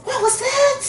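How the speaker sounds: a very high, squeaky kitten voice; startled question. A narrator's reading. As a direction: ask it high and astonished.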